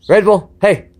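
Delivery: loud, high call to the dog. Real, not acted. Trainer calling his dog away from the fence. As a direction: call her in brightly across the yard. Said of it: "calling the dog over".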